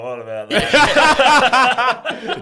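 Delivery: high, loud, slow laughing question; drawn-out laughing what about that; laughing disbelief. Retold or imitated. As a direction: stretch it out through laughter. Retelling the coffee chat.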